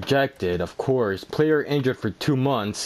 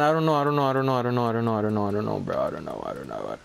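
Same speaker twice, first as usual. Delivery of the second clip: trails off softer and lower; fading despair; 7 semitones lower, 4 dB quieter, much faster than usual. Real, not acted. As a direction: let it fade into defeat. Dejected repetition fading away.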